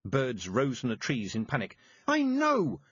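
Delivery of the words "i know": very high, loud scream; girl screaming at the witch. A narrator's reading. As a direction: fling it out at the top of her voice. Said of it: "screamed Tiffany".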